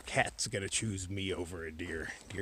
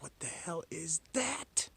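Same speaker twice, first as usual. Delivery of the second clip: high, slow, drawn-out disbelief; exaggerated breathless amazement; retold astonishment; 7 semitones higher, slower than usual. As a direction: stretch out the baffled question, rising high at the end. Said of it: "so I was like".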